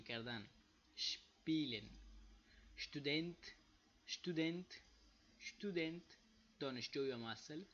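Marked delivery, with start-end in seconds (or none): 2.8-6.2 شتودنت with sh before the t, pitch lifted and rising; German pronunciation drill. Shown as a demonstration. Teacher drilling the German word student, careful repetition. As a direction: repeat شتودنت as a model with a clear sh-t. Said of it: "مثالایش هم است".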